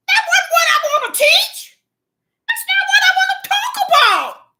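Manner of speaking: fervent high shout; preaching shout. Real, not acted. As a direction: let it burst out of you in the pulpit. Preacher erupting mid-sermon.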